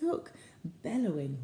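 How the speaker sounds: ordinary delivery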